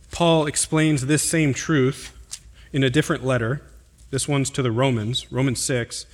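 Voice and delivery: usual speaking voice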